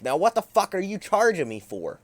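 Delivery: high, loud outraged demand; angry yell. Retold or imitated. The teacher yelling at him about the charge, angry high shout. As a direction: bark it out like an indignant teacher.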